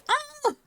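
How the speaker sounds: very high excited squeak; excited squeal. Real, not acted. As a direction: squeal with glee.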